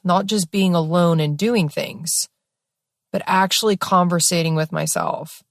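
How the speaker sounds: ordinary delivery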